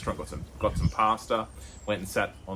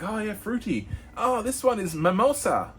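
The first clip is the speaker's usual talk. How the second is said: much higher, playful stoner voice; mock stoner; 13 semitones higher, 4 dB louder than usual. A tourist sniffing jars with the shop guy, in a high mock voice. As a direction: sniff and approve in a high silly voice.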